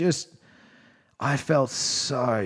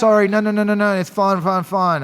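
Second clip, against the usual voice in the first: higher and louder, an imitated flustered mum; retelling mum backtracking, imitated high and flustered; 8 semitones higher, 8 dB louder than usual. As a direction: gush out a hurried apology as mum. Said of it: "And she's like".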